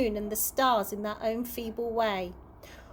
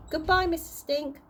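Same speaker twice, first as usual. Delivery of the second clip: higher, lightly voiced little girl; a child's goodbye, pitched up; child's farewell; 6 semitones higher than usual. A narrator's reading. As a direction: answer lightly in a higher girl's voice.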